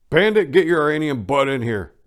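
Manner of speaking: calling out. Acted. Joe calls his friend in, loud and high. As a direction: yell for Bandit to come in. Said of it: "Joe said".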